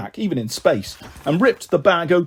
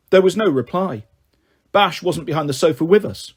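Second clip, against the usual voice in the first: ordinary delivery, nothing marked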